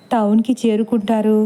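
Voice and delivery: plain reading voice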